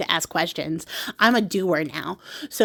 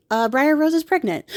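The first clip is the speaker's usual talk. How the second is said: normal talking voice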